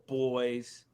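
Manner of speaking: normal talking voice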